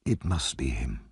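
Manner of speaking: barely voiced, thinking aloud; murmured to himself. Brownlow recognising Monks, murmuring softly to himself. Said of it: "murmured to himself".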